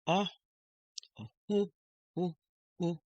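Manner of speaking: short, isolated "o" and "hu" sounds; o, then hu, hu, hu with gaps; vowel sound. Shown as a demonstration. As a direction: repeat hu in brief separate puffs. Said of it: "the sound is".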